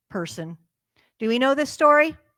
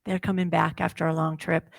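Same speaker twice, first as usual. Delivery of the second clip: usual speaking voice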